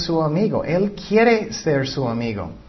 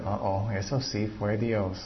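much softer, lower sheepish admission; sheepish realization; 7 semitones lower, 11 dB quieter than usual. Retold or imitated. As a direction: admit it quietly, a little embarrassed. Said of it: "tú eras".

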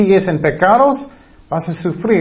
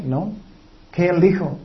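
normal talking voice

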